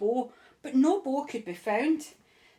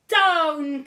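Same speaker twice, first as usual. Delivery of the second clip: loud urgent call; cried out; 5 semitones higher, 7 dB louder than usual. Acted. Witch calling her broom down, loud cry. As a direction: shout it down to the ground. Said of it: "cried the witch".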